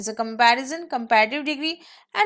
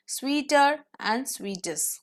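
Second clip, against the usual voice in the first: slow demo; much slower than usual. Shown as a demonstration. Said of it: "Simply you will put E R".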